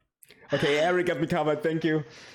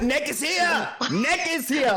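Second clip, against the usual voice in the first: high-pitched excited announcement; really excited; 10 semitones higher than usual. Real, not acted. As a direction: cry it out, thrilled at the arrival. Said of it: "They sound really excited to see Nick".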